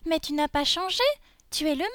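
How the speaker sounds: high, loud disbelief; exclaiming little girl. Acted. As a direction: exclaim as an astonished small girl. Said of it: "s'exclama Lolisa".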